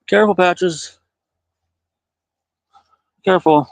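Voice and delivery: cat owner calling careful up the tree; calling a warning. Real, not acted. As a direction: call up a warning to the climbing cat.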